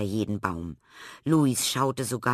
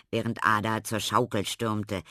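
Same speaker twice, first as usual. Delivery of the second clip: ordinary delivery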